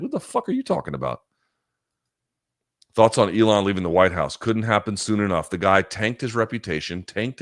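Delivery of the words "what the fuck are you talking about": imitating an outraged, much higher "what the fuck"; mock disbelief, pitch jumping high; incredulous. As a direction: play someone stunned by an insult, pitched way up. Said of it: "you'd be like".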